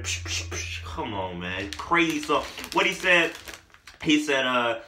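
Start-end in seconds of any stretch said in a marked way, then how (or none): 0.0-0.8 whispery psh gunfire noises; imitated gunshots. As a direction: fire off quick unvoiced psh shots. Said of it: "makes that sound".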